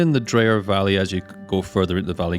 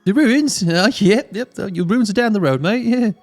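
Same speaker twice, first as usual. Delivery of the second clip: friendly hunter voice; 9 semitones higher than usual. Acted.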